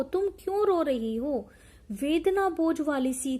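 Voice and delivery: ordinary talk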